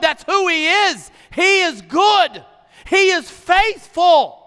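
high, loud, slow proclamation; preaching at full force. Acted. Pastor proclaiming God's goodness to the congregation, full-force preaching. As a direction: shout it out, every word hammered home. Preaching peak on God is good.

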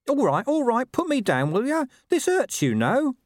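very high, squawky dog complaint; protesting dog. A narrator's reading. Dog held by the scruff, high indignant protest. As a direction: demand release in a squeezed high dog voice.

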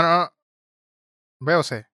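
usual speaking voice

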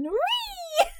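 mock cartoon cameo squeak; squeaky character call. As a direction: pop a silly squeal of the name. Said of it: "whenever I'm in something".

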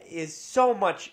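usual speaking voice

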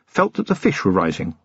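ordinary delivery

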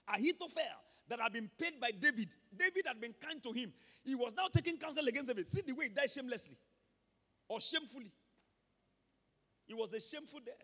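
usual speaking voice

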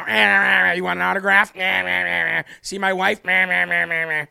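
mock bleating noises, pushed out loud; hammed-up mocking babble; mocking Ron DeSantis. Host ridiculing a candidate asking kids about autographs, mocking babble. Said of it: "He'd bobble his head and go".

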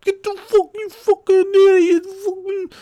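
imitated very high screech; hammed-up screaming tirade; yelling. Woman yelling at a dog that won't stay, imitated screaming. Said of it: "She's yelling at a dog".

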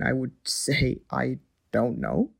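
slow, halting, unsure answer; hesitant admission. Acted. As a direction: drag it out while admitting ignorance.

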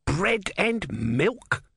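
high, very drawn-out indignation; outraged shout. A narrator's reading. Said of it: "shouted Om".

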